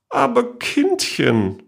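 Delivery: very high, slow, doting aunt; drawn out and soothing; indulgent aunt. A narrator's reading. Great-aunt soothing her worried niece, slow and very high.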